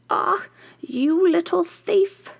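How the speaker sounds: high, slow, shrill-free witch cry of triumph; witch exclaiming. A narrator's reading. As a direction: pounce on the thief like a witch. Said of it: "she exclaimed".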